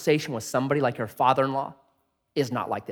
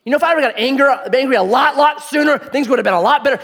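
mock regret; 9 semitones higher, 6 dB louder, faster than usual. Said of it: "Have you ever thought to yourself".

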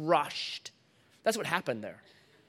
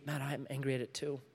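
hushed, low voice of Jesus; gentle reassurance; 5 semitones lower, 13 dB quieter than usual. Retold or imitated. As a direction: answer very softly, pitched low. Jesus gently answering the grieving speaker.

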